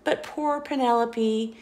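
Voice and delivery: plain reading voice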